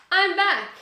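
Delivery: bright, excited announcement. Real, not acted. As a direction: proudly announce your return. Video comeback announcement.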